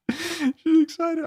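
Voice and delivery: very high, slow, squealing excitement; mock squeal. Mocking Natasha's glee about her thigh gap, high squeal. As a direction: squeak it out like a giddy fan.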